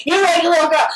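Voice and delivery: high, loud accusing yell; re-enacted shout. Heckler on stage accusing comic, shouted.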